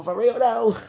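big joking throwback hype; Brat catchphrase. Pretend. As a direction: hit the nineties catchphrase loud and high. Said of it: "Hit the brat on him".

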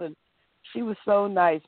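ordinary talk

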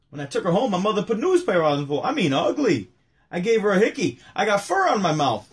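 high, loud stand-up delivery; Rodney punchline. Retold or imitated. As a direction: sell it like Rodney on stage. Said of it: "but he would say".

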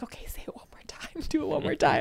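hushed, giggly egging-on; whispered coaxing; conspiratorial whisper. Retold or imitated. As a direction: whisper it like a secret dare. Sneakily egging a toddler on.

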